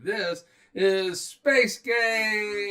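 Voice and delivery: big booming title shout; shouted announcement. Acted. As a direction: shout the show name out.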